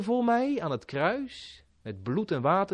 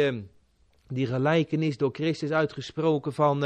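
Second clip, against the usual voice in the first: ordinary delivery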